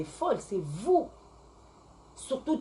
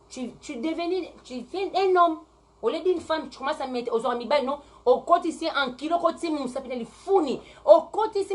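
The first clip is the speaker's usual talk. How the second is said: heated loud rant; 7 semitones higher, 3 dB louder than usual. Real, not acted. Heated rant about lesbians.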